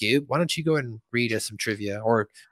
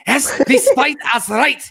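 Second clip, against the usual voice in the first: high, loud, slow mock German; hammed-up dictator shouting; Hitler-style rant; 10 semitones higher, 6 dB louder, slower than usual. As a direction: bellow fake German oratory. Imitating Cartman's little Hitler speech.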